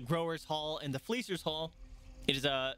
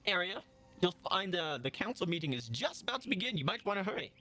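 higher, brisk innkeeper voice; 5 semitones higher than usual. Acted. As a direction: point the way as the eager barkeep. Innkeeper answers the player.

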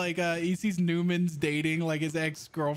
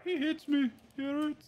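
high mock whimper, much higher pitch; mock whiny girlfriend; 10 semitones higher than usual. Friend mocking a teammate's complaint, high whiny voice. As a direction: tease him with a whiny girly voice. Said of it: "I sound like Poop's girlfriend".

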